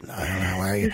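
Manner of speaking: imitating a croaky sick voice; weak, feeble put-on sick voice. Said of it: "Putting on the sick voice".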